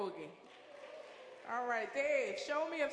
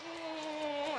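a playful kiss sound; blown kiss; 3 semitones higher than usual. Real, not acted. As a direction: blow the crowd a kiss. Host blowing kisses to audience.

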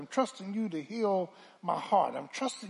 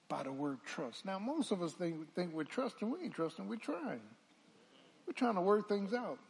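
ordinary talk

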